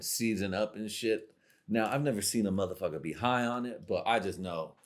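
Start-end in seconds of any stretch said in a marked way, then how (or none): none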